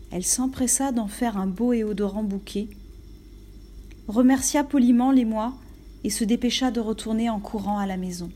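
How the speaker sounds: usual speaking voice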